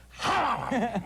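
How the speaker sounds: sudden high comic lion "Ha"; lion's roar. Acted. Lion answering a man in the lobby, mock roar. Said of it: "And the lion goes".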